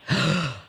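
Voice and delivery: sharp gasp of recognition. Retold or imitated. Waiter recognizes the host.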